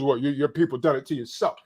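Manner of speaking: mimicking the man who blames his own people; mocking voice. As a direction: sneer it as the turncoat he mocks. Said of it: "use one of our own against us".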